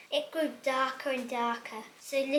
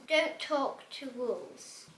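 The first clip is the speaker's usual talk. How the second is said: ordinary delivery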